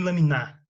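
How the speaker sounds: "laminar" said loudly as la-mi-NÁ; stress on last syllable. Shown as a demonstration. Teacher shows what not to say, loud stress on end of "laminar". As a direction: punch the final NAR of "laminar". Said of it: "nem laminar".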